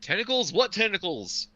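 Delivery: high, loud, baffled question; confused character. Acted. Player's character holding the relic, looking around, high and confused. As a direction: blurt it out in high-pitched bewilderment. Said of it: "looking confused".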